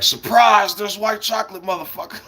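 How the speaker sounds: spring it like a gotcha, pitched high; surprise reveal. Acted.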